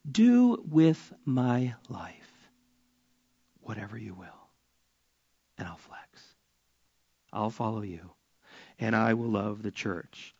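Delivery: whispered, surrendered prayer; hushed prayer. Acted. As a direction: breathe it as a quiet vow.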